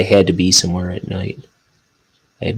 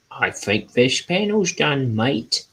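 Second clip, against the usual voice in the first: bad Cockney accent; 6 semitones higher, slower than usual. Artist jokingly checks his panel in a mock Cockney accent. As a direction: toss off a cheesy Cockney line. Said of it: "What the heck accent was that?".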